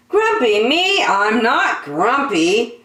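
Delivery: high, loud denial; insisting monkey. Acted. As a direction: Jim stamps out his protest. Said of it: "said Jim".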